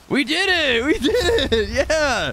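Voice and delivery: high loud victory cheer; excited cheer. Real, not acted. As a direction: celebrate the win. Celebrating a kill.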